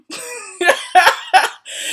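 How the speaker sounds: high, cracked-up laugh. Real, not acted. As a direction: burst out laughing at your own story. Cracking up at her story.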